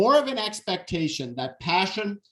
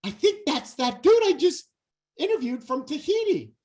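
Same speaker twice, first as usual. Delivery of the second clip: high, excited "that's that dude"; spotting a familiar voice with a lift; excited realization; 9 semitones higher than usual. Retold or imitated. To his friends on the beach, high and excited. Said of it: "I said".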